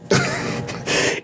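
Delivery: airy high squeak of laughter; breathy delighted squeal; high breathy giggle. Real, not acted. Laughing at the tailor scene.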